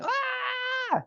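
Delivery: high held tantrum scream, kept soft; mock scream. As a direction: imitate a kid screaming without full volume. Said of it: "he starts screaming".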